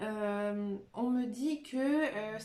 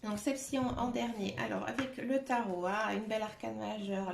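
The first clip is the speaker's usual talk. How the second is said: ordinary talk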